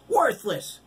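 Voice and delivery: very high, loud jeer; yelling back. A narrator's reading. Girl yells the insult back, very high. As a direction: fling it back at her. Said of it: "Carrie yelled back".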